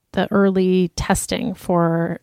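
usual speaking voice

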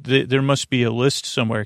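ordinary talk, nothing marked

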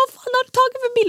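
high-pitched re-enacted rant; frantic, furious. Stressed driver to partner who rented the car, frantic fury. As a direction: act out losing it, shrill-high and sweary. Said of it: "Jag var helt galen".

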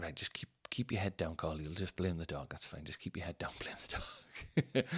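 low, hushed self-talk, fading to a whisper; furtive muttering. Retold or imitated. Man secretly telling himself to blame the dog, hushed mutter. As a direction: mutter it softly so nobody hears.

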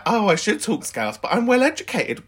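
high-pitched mocking imitation; exaggerated high mimicry of a caller; mimicking the caller. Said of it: "But you were like".

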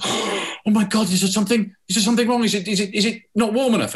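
flustered waiter fearing a complaint; horrified waiter. Acted. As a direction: plead in a flustered panic. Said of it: "the waiter is horrified".

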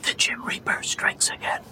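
whispered under the breath; a hushed ominous whisper; spooky whisper. Acted. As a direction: breathe it like a creepy aside. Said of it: "What was that?".

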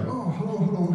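high, chirpy greeting; perky night clerk, pitched well up; Dutch desk clerk. Retold or imitated. The night clerk greeting a late guest, high and chirpy. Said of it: "the little Dutch guy at the counter".

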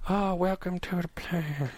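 drawn-out Indian accent impression; mock Indian accent. Shown as a demonstration. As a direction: put on a rough Indian accent, quietly. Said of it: "like, do an Indian accent".

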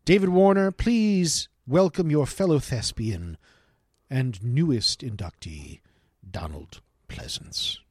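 slow, grand induction announcement; mock-solemn master of ceremonies, drawn out; ceremonial announcer.

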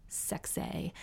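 soft unvoiced sarcastic whisper; deadpan whisper at yourself; whispered ironic aside; dry whispered sarcasm. Real, not acted. Dry self-deprecating aside.

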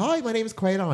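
high, eager hello; enthusiastic greeting. Retold or imitated. Straight over to greet her blind date, enthusiastic. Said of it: "all my enthusiastic, um, self".

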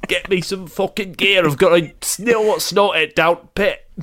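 Brixton geezer, slow on the boasts; lightly put-on Brixton bloke; London geezer accent. Dead granddad insisting he is from Brixton, London accent. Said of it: "I'm from fucking Brixton".

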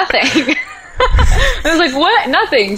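laughing. Retold or imitated. Retold exchange with laughter.

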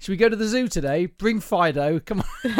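hammed-up jolly parent inviting the kids; mock cheery parent. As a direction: parent cheerily suggesting a zoo trip with the dog. Mocking parent taking dog to zoo.

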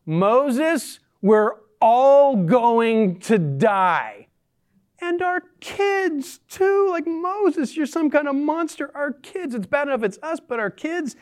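hammed-up high wailing protest, drawn out at first; melodramatic complaint. Thirsty Israelites blaming Moses for dooming their kids, melodramatic. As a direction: play the panicking complainers, way over the top. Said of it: "Dramatic here much".

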